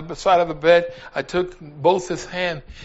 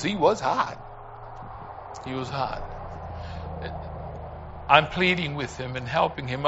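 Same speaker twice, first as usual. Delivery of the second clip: ordinary talk, nothing marked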